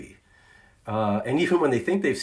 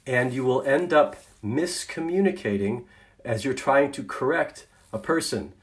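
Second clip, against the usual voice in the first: ordinary talk, nothing marked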